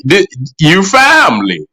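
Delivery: normal talking voice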